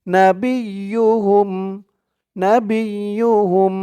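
نبيهم spelled slowly, syllable by syllable. Shown as a demonstration. Teacher spelling out the word slowly. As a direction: break نبيهم into slow syllables. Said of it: "হা দিয়ে মিম ধরবে".

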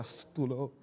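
low, hushed telling-off; soft, secretive "sss" reprimand; retold hush. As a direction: imitate shushing a friend under your breath. Retold hushing of his friend.

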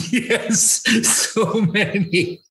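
high, laughing voice, drawn out at the end; laughing admission. Real, not acted. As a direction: admit it helplessly through laughter. Laughing admission of being intimidated.

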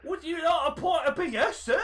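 high-pitched mock Cockney barkeep. Cockney offering sir a pint, high put-on Cockney accent. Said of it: "I'm a fucking cockney".

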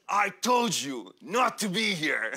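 slow, lightly voiced stern janitor; a hint of an angry old janitor scolding. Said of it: "and he says".

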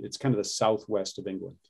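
ordinary delivery

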